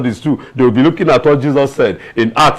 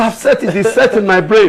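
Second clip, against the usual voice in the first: mock focused exam candidate; 10 semitones higher than usual. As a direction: play the student who won't be disturbed, giggling. Student acting out exam focus.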